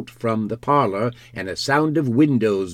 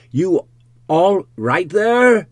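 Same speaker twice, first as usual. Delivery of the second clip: loud, high, drawn-out shout; insistent raised holler, slow on the words; sharp call; 10 semitones higher, 9 dB louder, slower than usual. A narrator's reading. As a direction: call sharply through the door. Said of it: "asked Mister Hall sharply again".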